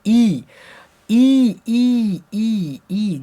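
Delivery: ई held as a long, high "ee"; teacher drilling a stretched ई; vowel demo. Shown as a demonstration. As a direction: sound out ई repeatedly, starting high and loud, easing off. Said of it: "ह्रस्वई का उच्चारण स्थान क्या है?".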